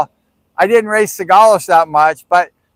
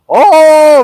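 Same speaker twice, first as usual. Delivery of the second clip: high, loud drawn-out oh; hammed-up "come on" reaction; mock exclamation; 9 semitones higher, 7 dB louder than usual. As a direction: scoff at the denial, pitched way up. Retold teasing answer to denial.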